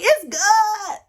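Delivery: high, overexcited "It's good"; squealing "It's good" in mock rapture; gushing mock rave. Shown as a demonstration. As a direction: gush like it's the best thing ever. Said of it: "You know, not like".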